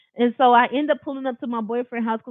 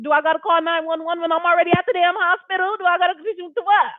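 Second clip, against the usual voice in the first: indignant rant; 5 semitones higher than usual. Retold or imitated. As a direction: tear into the officer for making her wait. She rants at hospital officer.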